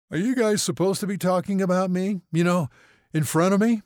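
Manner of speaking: high, loud, puzzled interruption; woozy young patient. A narrator's reading. Patient interrupting the arguing doctors, puzzled.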